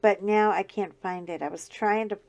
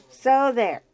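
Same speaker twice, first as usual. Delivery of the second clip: defiant so there; 4 semitones higher, 10 dB louder than usual. Acted. Teasing early Halloween shoppers, loud playful so there. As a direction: repeat So there louder and higher, teasing. Louder second retort to shoppers.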